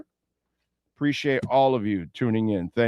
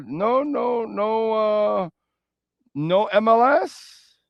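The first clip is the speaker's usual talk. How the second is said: surprised; 9 semitones higher, slower than usual. Real, not acted. Host surprised, checking career record.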